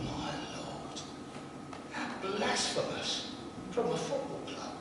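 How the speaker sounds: very soft, half-whispered aside; hushed retold thought. Preacher recalling his thought at a football club's Christmas card, hushed. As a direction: think it aloud under your breath.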